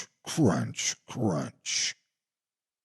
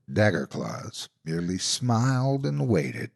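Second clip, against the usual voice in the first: plain reading voice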